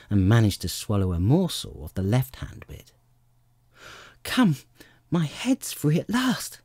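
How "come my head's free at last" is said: high, drawn-out cry of delight; Alice's delighted, lifted voice. A narrator's reading. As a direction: relish it with slow, high-pitched delight. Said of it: "in a tone of delight".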